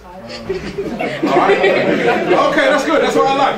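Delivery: laughing. Real, not acted. Crowd laughs, he laughs.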